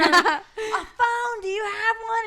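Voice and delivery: high, loud mock club yell; retold shouting over noise. Asking the DJ for a phone, high mock shout. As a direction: imitate calling out over loud music.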